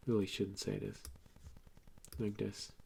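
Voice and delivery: ordinary talk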